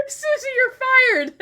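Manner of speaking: high, loud, slow tearful-laughing yell; high laughing you're fired; laughing mock firing. Real, not acted. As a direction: fire her in fake outrage.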